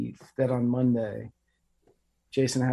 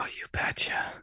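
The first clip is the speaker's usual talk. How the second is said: mock Midwestern, all in a whisper; whispered Midwestern accent; 6 dB quieter than usual. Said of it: "whisper and do a Midwestern accent".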